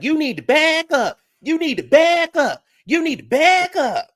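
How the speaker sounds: high, loud mock of Cynthia; high, loud hammed-up back up. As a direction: screech it up high like a scolding. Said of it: "She's like".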